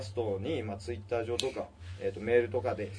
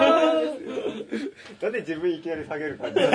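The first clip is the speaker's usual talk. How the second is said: high laughing tease; 10 semitones higher, 7 dB louder, slower than usual. Real, not acted. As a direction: squeal it through laughter. Teasing guest, laughing.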